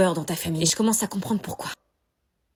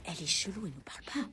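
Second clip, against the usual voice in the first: whispering while hiding; 3 semitones lower, 14 dB quieter than usual. Acted. Whisper about host.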